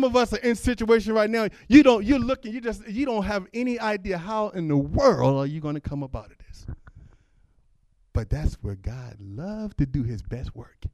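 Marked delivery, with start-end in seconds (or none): none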